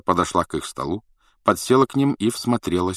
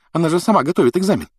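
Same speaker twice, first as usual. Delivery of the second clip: higher-pitched, hurried indignant remark; 11 semitones higher, 4 dB louder, much faster than usual. A narrator's reading. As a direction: scoff at it quickly, pitch jumping. Гермиона фыркает, возмущается экзаменом. (возмущённо, скороговоркой)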